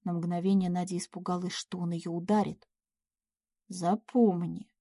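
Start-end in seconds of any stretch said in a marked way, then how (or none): 3.7-4.7 slow, weighted warning; measured, hard command; cold menace. A narrator's reading. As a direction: deliver it like a shot. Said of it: "словно выстрел в лицо".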